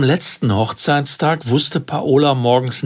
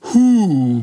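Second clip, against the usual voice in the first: short high startled huff; 6 semitones higher, 3 dB louder than usual. A narrator's reading. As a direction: let out a caught-out little hu. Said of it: "machte ich".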